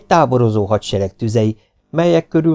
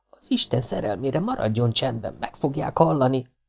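urgent hushing; 4 semitones higher, 7 dB quieter than usual. A narrator's reading. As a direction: hush her before anyone hears. Hiding girl hushes panicking companion.

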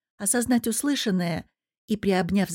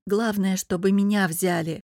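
usual speaking voice

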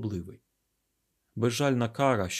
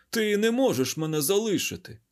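high, louder protest; pleading cry; 10 semitones higher, 5 dB louder than usual. Acted. Garuda begging not to be left, pleading cry. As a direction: cry out against being abandoned. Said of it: "слабко викрикнув".

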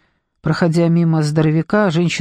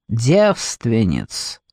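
very slow, lowered, cool voice; cold; 6 semitones lower, much slower than usual. A narrator's reading. Detective names the monster's preferred prey, coldly. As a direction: draw the word out low and cold. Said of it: "голос Джекоби был холоден".

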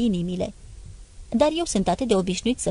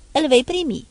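sharp, louder and higher retort; cutting tone; 6 semitones higher, 5 dB louder than usual. A narrator's reading. Father cutting off his son's question, sharply louder. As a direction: cut him off hard. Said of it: "i-am replicat cu un ton tăios".

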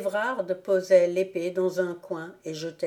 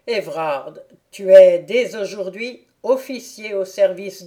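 a commanding count, louder and firm; stern proud father; 6 dB louder than usual. Acted. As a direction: announce his fortune with paternal pride. Count addresses his son.

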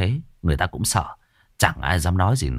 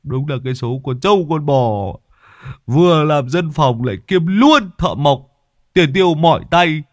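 higher, louder mock-whiny griping; grumbling complaint; 6 semitones higher, 3 dB louder than usual. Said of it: "Lâm Sẹo than thở". (than thở giọng cao, hơi to)

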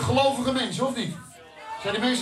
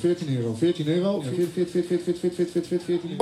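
lower, chanting auction call; auctioneer; 5 semitones lower than usual. Acted. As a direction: rattle off bids like an auctioneer.

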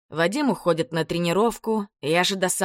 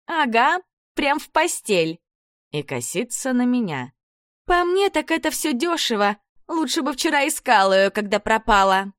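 put-on high scornful girly voice; sneering girl; 7 semitones higher, 3 dB louder than usual.

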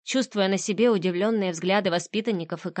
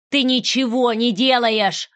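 louder, slower furious yell; 4 semitones higher, 6 dB louder, slower than usual. Acted. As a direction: roar it in her face. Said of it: "заорала она". (заорать в лицо)

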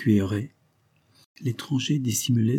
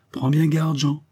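raised, higher voice; mild calling out; 6 semitones higher, 3 dB louder than usual. A narrator's reading. Mother calls to Jean leaving from the doorstep, calling out. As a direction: call out a warning in a higher voice. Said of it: "lui cria la réveillère".

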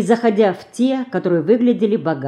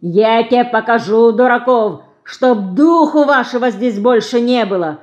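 higher, raised threatening rant; angry scolding; 4 semitones higher, 4 dB louder than usual. A narrator's reading. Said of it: "ругался дворник".

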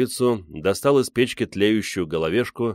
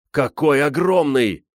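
high, drawn-out wonder; rapturous cry; 7 semitones higher, 4 dB louder, slower than usual. A narrator's reading. Гриша watches the bear from the window, thrilled. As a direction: stretch it out in awe. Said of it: "восторженно кричал Гриша".

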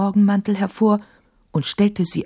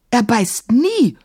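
higher, slow indignant denial; protest, stretching the last word; offended, emphatic contradiction; 7 semitones higher, 4 dB louder, slower than usual. A narrator's reading. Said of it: "sagte Emma empört".

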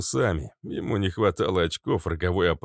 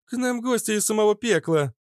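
put-on much higher, eager young girl; excited girl voice. A narrator's reading. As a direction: call everyone over excitedly. Fitilyok excited.

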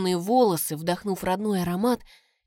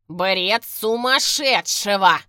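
slow, muffled distant yelling; 5 dB louder, much slower than usual. A narrator's reading. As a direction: yell from the far end of the line. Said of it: "приглушённый вопль мистера Уоррена".

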